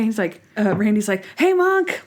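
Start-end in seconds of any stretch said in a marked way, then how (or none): 1.4-2.0 high-pitched excited greeting; mock excited hello, pitched way up. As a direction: greet him like a thrilled puppy. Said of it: "like all excited".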